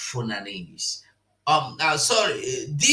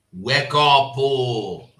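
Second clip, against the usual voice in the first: emphatic wake-up call; 5 dB louder, slower than usual. Real, not acted. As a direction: WAKE UP called out louder, drawn-out oh. Repeats the call louder.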